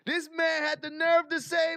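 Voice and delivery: outraged shout. Real, not acted. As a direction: yell it out in disbelief. Repeats line, then shouts it.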